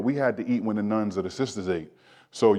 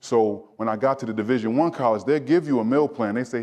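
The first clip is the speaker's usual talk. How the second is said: ordinary talk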